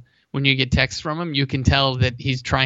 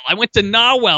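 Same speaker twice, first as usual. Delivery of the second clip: put-on Boston accent pitched up; imitated Boston accent; 6 semitones higher, 3 dB louder than usual. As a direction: mimic a local's Boston "Norwell". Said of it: "said it just like that".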